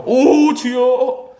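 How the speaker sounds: drawn-out tearful plea; anguished prayer cry. Retold or imitated. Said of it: "눈물 흘리고 무릎 꿇고 딱 기도하죠". (눈물로 길게 부르짖듯)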